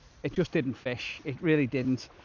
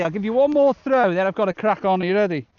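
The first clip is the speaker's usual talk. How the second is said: high, loud playful call; calling to dog; 5 semitones higher, 8 dB louder than usual. Real, not acted. Angler calling out to the dog before one last throw, louder and higher.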